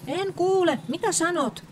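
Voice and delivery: high, loud shout over the traffic. A narrator's reading. Town mouse shouting to her cousin while driving fast, high loud shout. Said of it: "huusi Saara".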